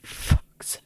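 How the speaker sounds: muttered under the breath, unvoiced; whispered. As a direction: hiss it so the baby can't hear. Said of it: "you're like".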